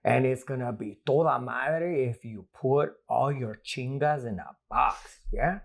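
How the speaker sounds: playing a Chicano uncle with easy swagger; Uncle Frankie impression. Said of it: "my Uncle Frankie".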